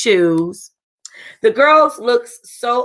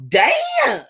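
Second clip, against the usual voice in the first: excited. Acted. Seeing the groom walk out.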